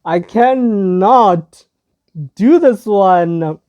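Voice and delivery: "I cannot do this one" drawn out, high and loud; slow, high, insistent "I cannot do this one"; emphatic refusal. Real, not acted. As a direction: stretch CANNOT slowly and loudly. Refuses another upsetting video.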